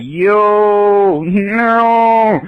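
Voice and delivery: high, long-held comic wail; retold dismay. Finding all car doors locked, high wailing dismay. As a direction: cry out in drawn-out mock despair.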